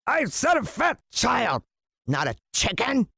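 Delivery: slow, high indignant scolding. A narrator's reading. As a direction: scold the snake, outraged. Villain scolding the snake.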